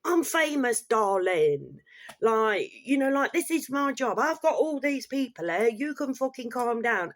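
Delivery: high, loud mock-diva boast; haughty star telling hospital staff to calm down; diva mimicry. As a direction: ham up a rude celebrity brushing people off. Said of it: "and she's like".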